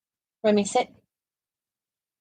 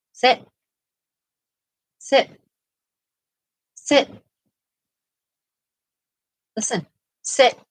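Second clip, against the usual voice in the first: firm dog command; 5 semitones higher than usual. Real, not acted.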